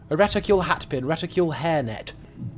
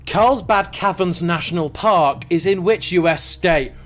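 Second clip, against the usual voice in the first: usual speaking voice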